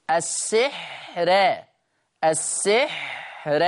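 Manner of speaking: السحر said slowly with a light, thin ra; السحر slowly, ra softened. Shown as a demonstration. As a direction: stretch out السحر and keep the ra thin. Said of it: "أنا عايز أسمعها را".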